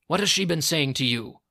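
loud, forceful questioning; angry demand. A narrator's reading. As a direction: demand an answer angrily. First demand, before the screamed repeat.

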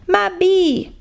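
ordinary delivery

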